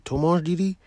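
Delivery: Tu manges didi? with the tune rising high at the end; rising question. Shown as a demonstration. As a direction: ask it, voice climbing on didi?. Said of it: "raise your intonation".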